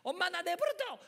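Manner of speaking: high-pitched irritated cry to mom; acted teen outburst. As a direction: play a sulky teen pushing mom away. Teen acted snapping at mother.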